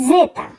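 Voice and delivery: জেতা said slowly and clearly; জেতা, Italian Z, carefully; careful zeta. Shown as a demonstration. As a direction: pronounce জেতা with care. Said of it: "খুব carefully উচ্চারণ".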